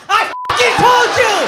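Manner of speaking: high, loud, drawn-out yell; gloating victory cry; triumphant shout. Real, not acted. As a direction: crow it slowly to the crowd. Comic crowing after correct guess.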